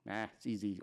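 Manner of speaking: ordinary delivery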